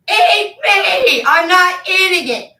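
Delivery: high, loud defiant shout; defiant yell. Retold or imitated. Retelling refusing pozole at dinner, a high defiant yell. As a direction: yell it in full revolt.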